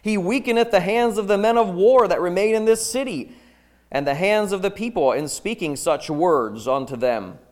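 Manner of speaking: loud, insistent demand; forceful reading. Acted. Princes demanding Jeremiah's death, loud and insistent. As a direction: press the king hard for his death.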